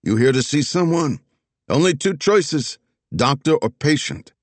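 put-on impatient old man, Philly accent; Philly accent with clipped impatience; annoyed Philly accent. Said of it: "already annoyed".

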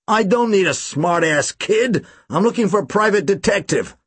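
a touch of brash Chicago contempt; American accent, scornful. Acted. American client sneers at the kid in an American accent. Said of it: "He spoke with an American accent".

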